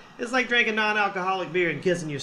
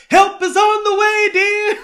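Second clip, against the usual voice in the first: very loud, high falsetto nanny; Missus Doubtfire; 10 dB louder than usual. Acted. Nanny rushing to the choking man, loud falsetto cry. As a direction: sing out in a shrieky falsetto. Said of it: "divorce movies is Missus Doubtfire".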